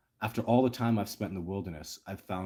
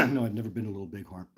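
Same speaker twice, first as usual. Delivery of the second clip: coughing, then talking through it; chesty cough; faster than usual. Real, not acted. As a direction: cough first, then answer quickly. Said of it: "Sorry, I'm coughing again".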